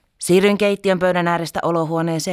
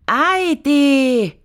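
slow, high, louder call breaking the daydream; drawn-out shout; 11 semitones higher, 7 dB louder, much slower than usual. Retold or imitated. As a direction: wail for mum again, impatient. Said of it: "huusin ja keskeytin unelmointini". (venytetty huuto äidille)

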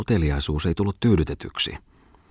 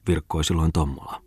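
ordinary delivery, nothing marked

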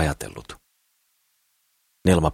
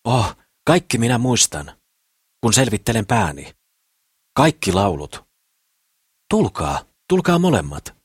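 higher-pitched, lively boastful suitor; eager Kukkelman; 6 semitones higher, 4 dB louder than usual. A narrator's reading. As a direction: coax them out with big promises. (innokkaasti houkutellen, korkeammalla äänellä)

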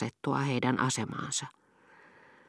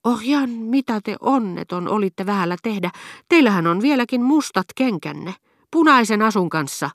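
high, raised voice of alarmed outrage; louder, higher exclamation of a scandalized husband; terrible shout; 7 semitones higher, 5 dB louder than usual. A narrator's reading. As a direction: cry out in horrified reproach, pitched well up. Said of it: "huusi hirmuisella äänellä".

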